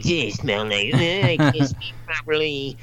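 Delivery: garbled, mush-mouthed self-impression; exaggerated bad-speaker voice, drawn out; mock recorded voice. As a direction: mangle the words as if you can't speak properly. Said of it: "when I listen back to it".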